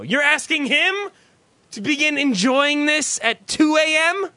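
outraged on someone else's behalf; indignant disbelief. Real, not acted. Host berating late caller for making a fan wait, indignant.

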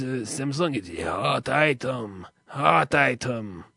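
low, slow put-on voice of a droning store clerk. Comedian mimics the vendor clerk in a low drone. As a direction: drone it out like a bored vendor on the phone.

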